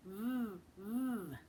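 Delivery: long buzzing vvv with lip bitten; voiced vvv sound. Shown as a demonstration. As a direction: buzz the V with voice on. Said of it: "you do use your voice".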